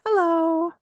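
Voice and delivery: put-on a bright high hello for a child to copy; sing-song hello. Shown as a demonstration. Modeling greeting.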